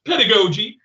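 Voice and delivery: amused laughing repeat. Real, not acted. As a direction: laugh out the word "Pedagogy", amused.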